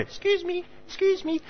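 put-on high, soft little old lady calling; lightly voiced timid old lady.

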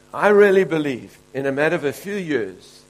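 loud, rising preacher's declaration; fiery preaching. Real, not acted. Preacher proclaiming the Springboks' future, loud and rising. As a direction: proclaim it to the whole congregation.

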